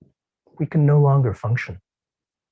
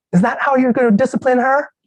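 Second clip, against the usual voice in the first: much higher, indignant yell; screaming wife; 12 semitones higher, 3 dB louder than usual. Acted. Wife storms in and screams at her husband, high and angry. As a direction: demand it at him as you storm in. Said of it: "Loretta barged in screaming".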